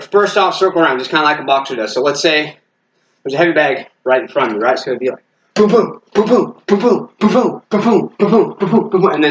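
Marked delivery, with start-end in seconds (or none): 5.5-9.1 rapid-fire higher-pitched booms; urgent bursts of imitated impacts; intense punch sounds. Shown as a demonstration. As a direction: voice quick double hits on a heavy bag. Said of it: "'Cause that's gonna be intense".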